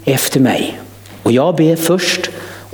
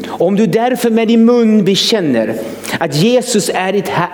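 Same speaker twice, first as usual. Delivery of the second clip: normal talking voice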